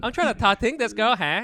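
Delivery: light mock Vietnamese accent on English words. Bragging to a friend, light Vietnamese accent. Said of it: "you get an accent".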